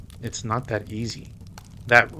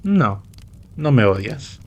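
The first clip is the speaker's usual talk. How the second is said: loud playful no, no me odias; loud teasing denial; 8 dB louder than usual. Acted. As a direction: brush her off, teasing and loud.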